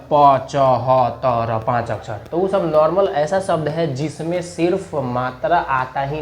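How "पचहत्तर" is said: पचहत्तर stretched very slowly, every syllable long; mock overlong पचहत्तर; drawn-out wrong reading. Shown as a demonstration. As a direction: drag out पचहत्तर syllable by syllable as the mistake. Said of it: "अब ये मत कह दीजिएगा कि".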